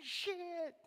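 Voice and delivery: very high, loud outburst of alarm; panicked yell. Acted. Father far from his hot tub, panicked yell. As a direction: build to a high-pitched, hammed-up shout.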